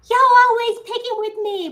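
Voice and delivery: high, loud mock whine; imitating husband. Imitating husband defending his gifs, a mock whine. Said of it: "He's like".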